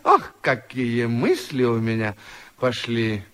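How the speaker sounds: usual speaking voice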